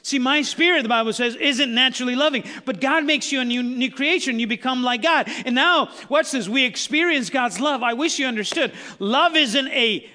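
loud, rising pulpit preaching; full-throated sermon delivery; fervent preaching. Acted. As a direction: preach it out to the whole room.

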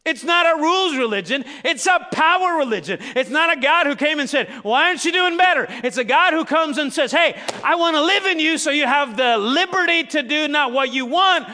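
loud, high, driving sermon voice; full-force preaching. Acted. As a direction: preach it hard to the back row.

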